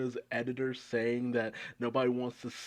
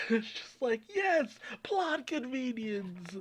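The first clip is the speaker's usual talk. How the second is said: high, drawn-out mock delight; sarcastic; 12 semitones higher than usual. Real, not acted. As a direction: lay the irony on slowly. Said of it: "sarcastic aside".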